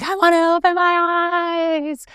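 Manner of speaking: high, drawn-out impatient wail; hammed-up childish plea; mock whining. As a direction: whine it slowly on a held high note. Acting out her impatience with eyes closed.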